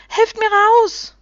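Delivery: high, loud, drawn-out call; calling for help. A narrator's reading. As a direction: call out to be pulled free. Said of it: "rief sie".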